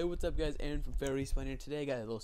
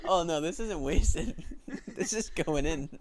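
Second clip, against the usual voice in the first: laughing; 3 semitones higher, 5 dB louder, slower than usual. Real, not acted. Laughing at partner's blunder.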